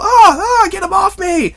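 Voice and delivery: high panicked mock shriek; panicked yelp. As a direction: squeal it in a panic. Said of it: "you were all".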